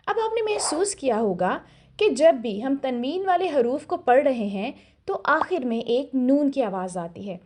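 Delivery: ordinary talk, nothing marked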